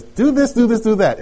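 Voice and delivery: bossy mom. Acted. Mother telling the boys to do chores, mock bossy. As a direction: imitate a mother ordering her kids around. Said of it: "Betty tells the boys".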